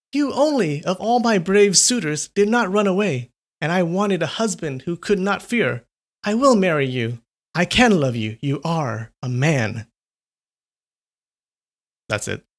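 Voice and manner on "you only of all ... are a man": put-on higher, delighted voice of the girl; girl cries out happily. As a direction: proclaim it, full of joy. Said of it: "with a burst of laughter and cried".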